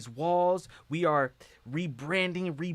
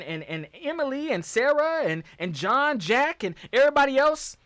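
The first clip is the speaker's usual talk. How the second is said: indignant rant; 7 semitones higher, 4 dB louder than usual. Real, not acted.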